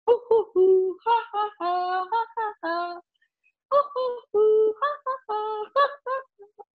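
high hoo hoo then ha ha laughs; laughter hearts. Shown as a demonstration. Teaching the heart laughter to the class, high laugh. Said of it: "we're going to go".

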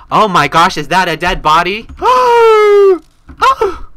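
very high, loud gasping shock; hammed-up horror. Finding a dead body in her mock intro, hammed-up shriek.

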